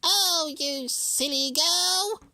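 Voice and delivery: high, loud, slow crackling hag; cackling witch. A narrator's reading. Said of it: "crackled the witch".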